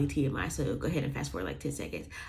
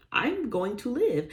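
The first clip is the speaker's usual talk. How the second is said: higher, slow, relieved joy; 8 semitones higher, slower than usual. Retold or imitated. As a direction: let the relief break through. Relief after near death.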